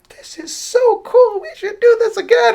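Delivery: very high, drawn-out mock girlish gush; gushing young girl. Bandmate gushing to the others after playing, high imitated girlish excitement. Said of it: "one of the girls like".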